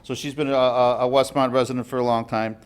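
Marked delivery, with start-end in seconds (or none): none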